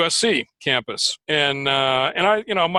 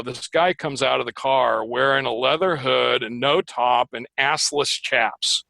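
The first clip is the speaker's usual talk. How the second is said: normal talking voice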